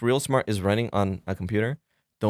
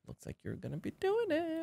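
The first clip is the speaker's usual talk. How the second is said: high mock voice; 11 dB quieter than usual. Director voiced in retelling.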